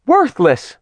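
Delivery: high shriek, far off; shriek from another room. A narrator's reading. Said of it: "Artemis's shriek from the other room".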